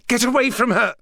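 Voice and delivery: high, loud shout; yelling. A narrator's reading. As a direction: yell it to stop him. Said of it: "Sarah yelled".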